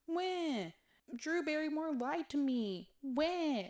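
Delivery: high, loud mock whining like a bratty kid; hammed-up crybaby wail at being lied to; imitating a sulky prince whining like a toddler; petulant child whine. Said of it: "he is a petulant little child".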